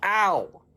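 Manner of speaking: high sharp yelp of pain; worm's ow. Acted. Worm bumping into the apple, high yelp. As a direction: yelp as the head bumps. Worm bumps his head.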